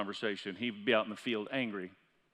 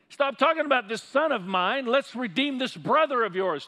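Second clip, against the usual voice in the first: father turning it back; 10 semitones higher, 7 dB louder than usual. Retold or imitated. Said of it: "he turned it back to him".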